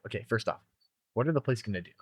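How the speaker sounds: low, soft murmur; quietly spoken, pitched low; hushed. Real, not acted. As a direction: keep it down, well under talking volume. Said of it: "you might wanna say that a little bit louder".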